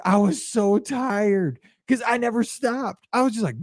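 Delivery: ordinary delivery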